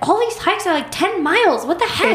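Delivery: high, incredulous whine at the long hikes; mock exasperation. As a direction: squeak it out like you can't believe it. Exasperated retelling of own reaction.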